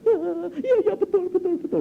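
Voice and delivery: high, loud hammed-up blubbering; imitating a man bawling his admission; mock crying. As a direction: wail it like a little child. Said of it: "Nangis kayak anak kecil".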